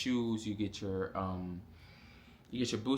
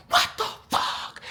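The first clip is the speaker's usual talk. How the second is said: slow, louder outburst mimicking an irate drill sergeant; ranting drill sergeant; 6 dB louder than usual. Drill sergeant sees trainee walk the wrong way, going crazy. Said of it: "she just going crazy".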